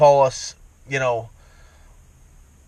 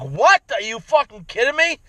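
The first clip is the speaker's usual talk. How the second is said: incredulous outburst; 8 semitones higher than usual. Retold or imitated. A voter reacting to a poll question in higher-pitched incredulous disbelief. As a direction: snap back in mock outrage at a silly question. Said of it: "people are thinking like this".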